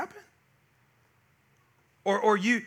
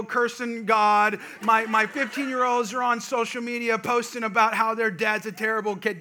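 normal talking voice

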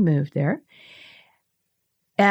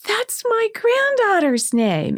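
high and drawn out, slow with wonder; amazed; 13 semitones higher than usual. Retold or imitated. Telling the German lady about the shared name, slow amazed high voice. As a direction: stretch it out in delighted disbelief.

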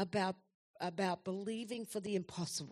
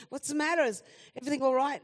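retold alarmed question, much higher and louder; alarmed mother; 5 semitones higher, 7 dB louder, faster than usual. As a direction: replay the jolt of fear at the phone call. Mother answers her sobbing son.

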